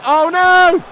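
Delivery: high, loud cry of horror; dismayed shout. Real, not acted. Streamer watching his lead slip, dismayed shout. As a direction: wail it as it goes wrong. Conceding a chance.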